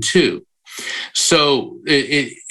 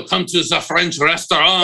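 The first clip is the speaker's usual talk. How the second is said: mock French accent; 5 semitones higher than usual. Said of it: "You know how we go".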